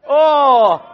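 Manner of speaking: high, loud gotcha exclamation; loud surprised oh. Real, not acted. Quiz host catching players who cheated, loud surprised call. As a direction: burst out as if catching someone red-handed.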